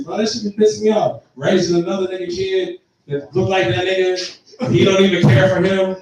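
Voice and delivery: usual speaking voice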